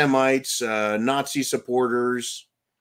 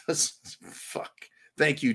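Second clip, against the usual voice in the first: ordinary delivery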